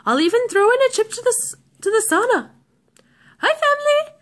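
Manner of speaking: high, singsong shopkeeper offer; salesman voice. Retold or imitated. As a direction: imitate a chirpy film shopkeeper. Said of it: "where he goes".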